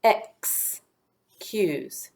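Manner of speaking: "ex, cues" with a clear pause between; "ex, cues" broken apart to show the short vowel; split syllables. Shown as a demonstration. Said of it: "In a closed syllable".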